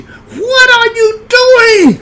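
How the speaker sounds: imitated shrieking hostess, very high and loud; banshee scream. Hostess catching boy in her backyard, banshee scream. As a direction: scream it long and drawn-out like a banshee. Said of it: "She screamed like a banshee".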